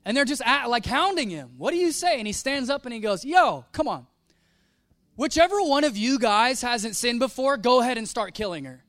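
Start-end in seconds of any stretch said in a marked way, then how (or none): 1.6-2.2 hounding question. Retold or imitated. Crowd hounding Jesus. 3.2-8.8 bold challenge. Retold or imitated. Jesus challenges the accusers.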